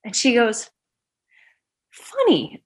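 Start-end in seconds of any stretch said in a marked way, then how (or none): 1.9-2.6 wondering. Retold or imitated. Nurse voiced.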